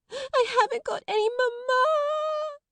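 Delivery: put-on a spoiled little girl sobbing for her mother; howling. A narrator's reading. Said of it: "Lottie howled tempestuously".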